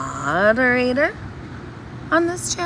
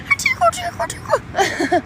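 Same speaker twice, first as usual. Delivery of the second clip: high squeaky sing-song tickle. Shown as a demonstration. Showing chat the tickle torture, squeaky playful tickle. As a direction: demonstrate the diabolical tickle.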